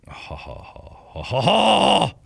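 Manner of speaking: breath-only ha-has swelling to a high, loud cry; staged laugh from faint to loud; huffed ha-has that erupt; building mock laugh.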